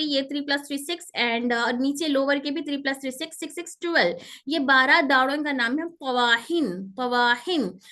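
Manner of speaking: usual speaking voice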